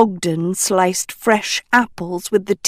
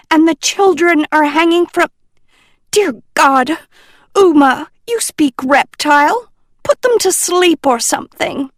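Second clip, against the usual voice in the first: squawk; 8 semitones higher, faster than usual. A narrator's reading. A professor alarmed at snakes in the cave, mild squawk. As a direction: let a hint of alarm squeak through. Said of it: "she squawked".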